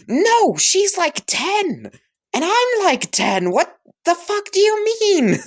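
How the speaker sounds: very high, loud shriek; shrieking outrage. Acted. Son shrieks at his mother in outrage, very high and loud. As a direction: scream it in disbelief. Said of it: "Bakugo would go".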